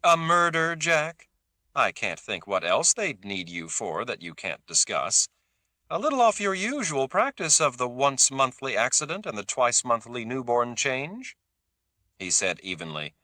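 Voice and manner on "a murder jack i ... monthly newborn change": mocking. A narrator's reading. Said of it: "humility already replaced by mockery".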